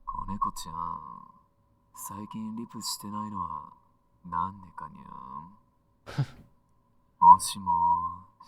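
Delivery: teasing message through a phone filter; thin band-limited voicemail message; voicemail effect. Acted. Boyfriend leaving his kitten a message, voicemail sound. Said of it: "doesn't sound like it's on voicemail".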